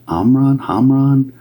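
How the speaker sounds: "Amran, Hamran" held high, with open AH vowels; trying out name. Shown as a demonstration. Medium testing a half-heard name, "Amran, Hamran" sounded out high. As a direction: try "Amran" without the H, then "Hamran" with it. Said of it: "or something like that".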